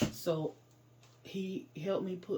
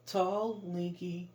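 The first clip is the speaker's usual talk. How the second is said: ordinary delivery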